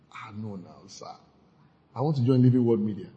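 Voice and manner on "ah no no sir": mock refusal.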